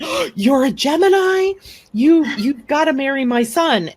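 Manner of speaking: gasping, high, loud delight; retold excited exclamation; excited mother-in-law. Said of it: "she went".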